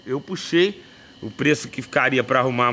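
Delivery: usual speaking voice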